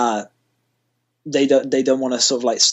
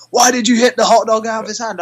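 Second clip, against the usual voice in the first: accusing mother; 7 semitones higher, 3 dB louder than usual. Retold or imitated. Mother confronts him at merch stand.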